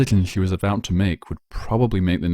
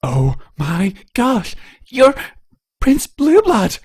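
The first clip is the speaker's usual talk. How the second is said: much higher, louder fangirl shriek; excited squeal; 6 dB louder, much slower than usual. Acted. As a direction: scream it like meeting your idol. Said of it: "almost screamed in excitement".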